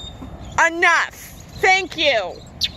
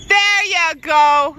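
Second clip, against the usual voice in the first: ordinary delivery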